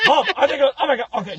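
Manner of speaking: high, loud mock alarm; excited tow driver. Tow driver thinking he heard a crash, mock excitement. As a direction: imitate a driver jumping at a noise.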